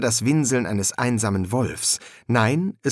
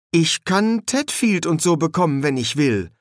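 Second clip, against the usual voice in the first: higher-voiced sulky insistence; sullen defiance; 6 semitones higher than usual. A narrator's reading. Boy defends his claim to his friends, sullenly defiant. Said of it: "Verdrießlicher Trotz zitterte in seiner Stimme".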